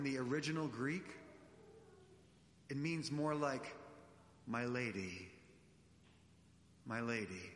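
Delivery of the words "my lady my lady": low and softer, gallant; chivalrous. Retold or imitated. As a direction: greet her like a gentleman, gently. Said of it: "Jesus is such a gentleman".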